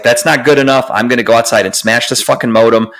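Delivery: usual speaking voice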